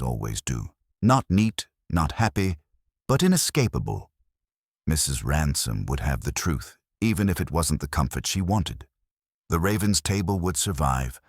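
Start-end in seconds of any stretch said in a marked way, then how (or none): none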